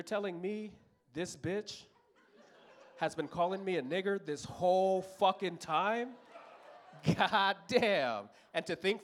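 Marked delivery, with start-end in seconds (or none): none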